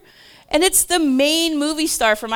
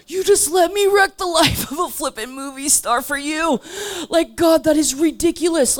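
louder, higher exasperated outcry; exasperated exclaim; 8 semitones higher, 5 dB louder than usual. Retold or imitated. Telling God off in loud exasperation. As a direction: throw up your hands at God.

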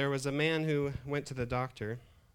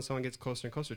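normal talking voice

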